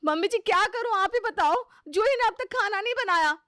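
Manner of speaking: high, loud aggrieved complaint; complaining sister-in-law. Acted. Sister-in-law complaining to mother-in-law about the bride.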